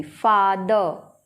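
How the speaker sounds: फादर stretched slowly, long aa; slow father demo. Shown as a demonstration. Teacher slowly repeats फादर for the class. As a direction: linger on the aa of फादर. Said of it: "आ की sound होगी".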